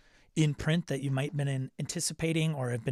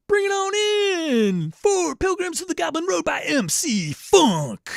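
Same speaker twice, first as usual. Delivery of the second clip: much higher and louder, slow arena announcer call; announcer hype; 9 dB louder, slower than usual. Acted. Introducing M C Funk to the crowd, announcer hype. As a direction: boom it out like a ring announcer. Hypes author name like announcer.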